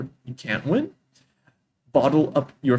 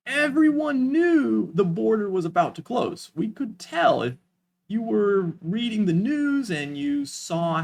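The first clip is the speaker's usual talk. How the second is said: higher, building emphasis with pauses; dramatic pausing; 6 semitones higher than usual. Shown as a demonstration. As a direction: hang on "you", pause, then hit "knew". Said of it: "pay attention to the pausing for this one".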